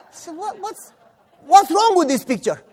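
high, loud incredulous question; loud bafflement. Retold or imitated. Boy discovering the staged beating, loudly baffled. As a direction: blurt it out in disbelief.

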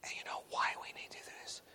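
quick hushed whisper. Retold or imitated. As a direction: ask it in a confidential whisper. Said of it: "we begin whispering to each other".

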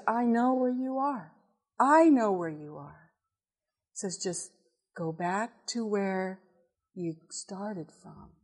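very calm voice. Retold or imitated. The Lord calming the lost wife, very calm voice. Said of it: "Very calm voice".

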